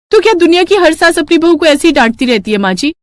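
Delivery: sass her mother-in-law sweetly; bright mock-innocent retort; cheeky daughter-in-law. Bahu argues.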